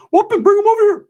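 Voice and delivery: squeaky high falsetto cousin; eager welcome in a cartoonishly high voice; Ricky. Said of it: "I talked to Rick".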